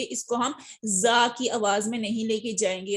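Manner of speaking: ordinary talk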